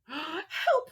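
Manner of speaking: high frightened call; cry for help. Acted. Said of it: "cried the witch".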